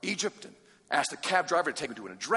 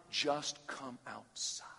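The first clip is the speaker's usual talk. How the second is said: slow breathy whispered plea; 3 semitones lower, 11 dB quieter, much slower than usual. Acted. Preacher urging students to come outside, slow whisper. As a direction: whisper it slowly to the room.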